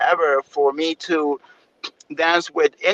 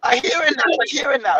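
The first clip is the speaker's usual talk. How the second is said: higher-pitched, thrilled realization; retold excitement; 7 semitones higher than usual. Dancer recalling the music opening up his ear, excited. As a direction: relive the excited moment of finally hearing it. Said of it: "super excited, 'cause I was like".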